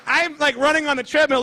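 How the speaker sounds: ordinary talk, nothing marked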